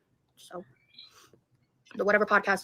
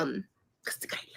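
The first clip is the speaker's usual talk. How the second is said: whispering; 7 semitones lower, 14 dB quieter than usual. Real, not acted. Keeping her idea secret.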